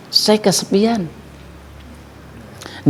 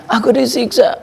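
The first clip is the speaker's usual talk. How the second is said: put-on child pleading; 8 semitones higher than usual. Dead child cries to mother.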